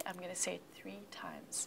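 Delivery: whispered aside before the drill; quiet aside. Real, not acted. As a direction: drop to a hush. Teacher hushes before drill.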